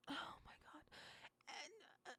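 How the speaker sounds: breathy unvoiced whisper; whispered disbelief. Real, not acted.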